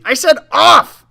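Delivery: very high, loud cross shout. Acted. Old woman yelling at the boy over the stove, cross shout. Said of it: "she shouted crossly".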